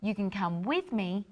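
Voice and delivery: with stretched and clear; stress on WITH. Shown as a demonstration. Teacher explains stress on with, together. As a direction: lean on the word with. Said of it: "or I can go by myself".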